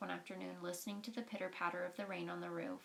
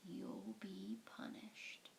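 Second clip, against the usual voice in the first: low, slow, ominous whisper; slow unvoiced threatening whisper; 8 semitones lower, 10 dB quieter, much slower than usual. A narrator's reading. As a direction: breathe a curse at them.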